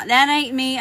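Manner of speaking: ordinary talk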